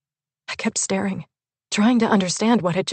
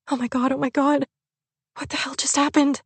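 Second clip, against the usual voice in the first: shocked panic; 7 semitones higher than usual. A narrator's reading. Witnessing a sudden shooting.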